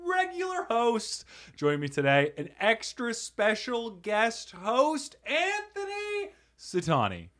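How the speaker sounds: high, drawn-out announcer voice; grand announcer. Acted.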